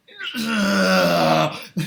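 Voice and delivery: big high delighted laugh; loud burst of laughter. Real, not acted. Proud moment, pose pulled off.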